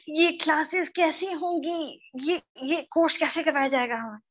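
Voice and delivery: hammed-up worrying student; mock anxious student. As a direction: fret playfully like a stressed-out student. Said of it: "हाय हाय मैं मर जाऊं वाली tension".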